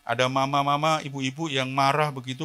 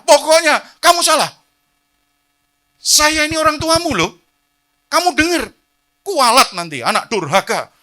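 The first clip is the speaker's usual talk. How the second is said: angry scolding parent; 15 dB louder than usual. Retold or imitated. Mock angry parent scolding child.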